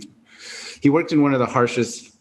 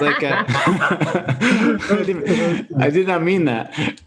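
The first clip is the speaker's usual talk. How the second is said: high, helpless laughing through the words; real laughter breaking up the sentence; 8 semitones higher, much slower than usual. Real, not acted. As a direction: crack up while trying to explain yourself. Cracks up at own accidental joke.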